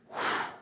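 soft, voiceless blown breath. Shown as a demonstration. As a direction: demonstrate breathing onto someone. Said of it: "he breathed".

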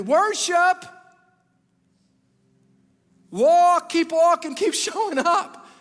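loud, high, driving sermon exhortation; preaching at full force. Acted. Pastor urging the congregation, preaching at full force. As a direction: hammer each command home to the crowd.

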